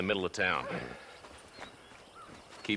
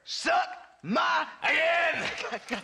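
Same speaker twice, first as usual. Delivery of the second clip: much higher, slow, bellowing shout; furious yell; much slower than usual. Acted. Captive Shaky screaming defiance at his captors, furious yell. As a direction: roar it at them with all you have.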